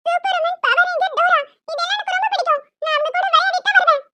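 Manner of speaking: put-on high, excited kid listing heroes; eager child. Little girl telling mum she wants to play. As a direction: gush like a small fan.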